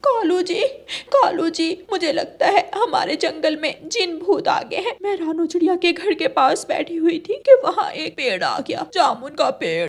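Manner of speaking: giggly, breathless gossip about ghosts; a hint of a jittery bird telling her husband; excited cartoon crow wife.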